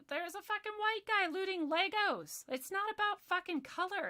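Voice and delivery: high, loud exasperation; indignant outburst. Real, not acted. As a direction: raise the voice in outrage. Indignant outburst over looter.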